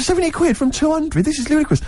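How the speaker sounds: very high, incredulous protest; retold disbelief, voice pitched right up. Astonished at the falling price.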